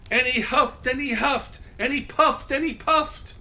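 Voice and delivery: ordinary delivery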